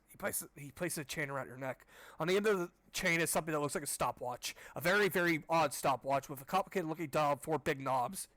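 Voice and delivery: ordinary talk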